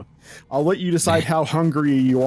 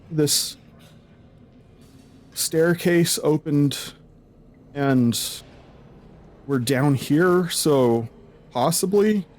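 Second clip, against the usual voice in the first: shaky townsperson; 4 semitones higher, slower than usual. Acted. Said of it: "The one who seems the most composed is like".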